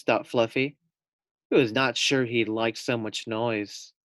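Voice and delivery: plain reading voice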